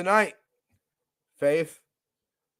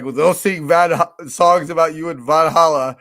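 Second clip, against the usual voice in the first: louder, grand Valhalla send-off; mock-epic friend quote; 7 dB louder than usual. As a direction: quote him like a war saga. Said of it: "Jason was like".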